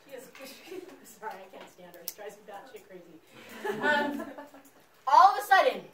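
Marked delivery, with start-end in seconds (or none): 5.1-5.8 loud, dramatic all of a sudden; storyteller's sudden loud cry; sudden loud burst. Acted. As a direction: burst out with it to grab the class.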